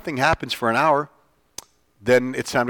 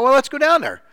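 eager suggestion; 13 semitones higher, 3 dB louder than usual. Retold or imitated. Retold decision to go.

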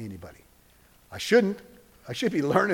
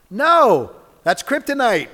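loud, higher exclamation; emphatic no; 9 semitones higher, 10 dB louder, slower than usual. Real, not acted. Preacher to congregation, rejecting the lie loudly. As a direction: slam the lie down.

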